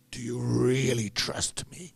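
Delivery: big Russian man leaning in; intimidating Russian. Retold or imitated. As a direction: press him with the question, slow and heavy. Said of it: "he's intimidating me".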